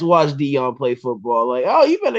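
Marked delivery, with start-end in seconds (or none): 1.6-2.2 high mock parent warning. Podcaster voicing parents, high mock scolding. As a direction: squeak it like a bossy parent.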